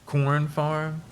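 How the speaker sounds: high, loud surprised question. Real, not acted. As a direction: blurt it out in surprise. Surprised at finding corn farm.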